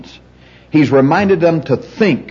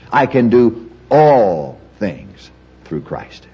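very slowly, stretch "all" and separate what follows; stress on all; much slower than usual. Shown as a demonstration. Teacher works through the verse word by word, stress on all. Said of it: "Emphasize each word in the verse slowly".

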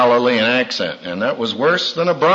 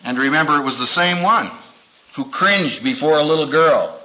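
ordinary talk, nothing marked

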